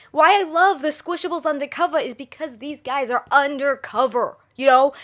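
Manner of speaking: ordinary talk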